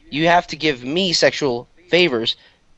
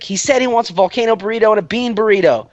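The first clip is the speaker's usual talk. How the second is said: raised, higher relaying voice; re-enacted calling out; 7 semitones higher than usual. Relaying the friend's order to the car, calling out. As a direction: pitch it up as if calling across.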